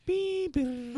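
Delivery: high mock modem beeping; imitating dial-up beeps. Teasing a friend about dial-up, imitating modem beeps. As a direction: chirp it like an old dial-up modem. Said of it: "just to hear the sound".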